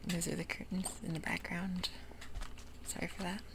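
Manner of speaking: usual speaking voice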